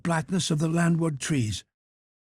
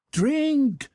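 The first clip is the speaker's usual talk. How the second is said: high, stretched command; crying out; 11 semitones higher than usual. A narrator's reading. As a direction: cry the order out high and long. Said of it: "cried Montgomery".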